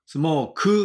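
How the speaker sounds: "スモーク" with a drawn-out Japanese "kuu" ending; "スモーク" katakana-style, ku voiced and stretched; wrong Japanese-style pronunciation. Shown as a demonstration. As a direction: say "スモーク" with a full vowel on the final ku. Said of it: "って言わないで".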